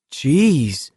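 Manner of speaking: half-voiced excited yell; mild yell. A narrator's reading. Baker amazed by the cheering crowd, mild yell. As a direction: call out in amazement, held back. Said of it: "Baker yelled".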